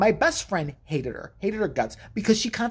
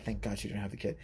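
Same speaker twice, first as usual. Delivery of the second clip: quiet aside; 10 semitones lower, 12 dB quieter, faster than usual. Real, not acted. Aside about the abortion.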